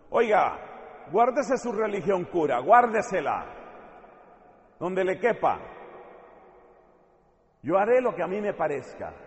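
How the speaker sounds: defiant retort. Acted. Defiant man rebuffs the priest.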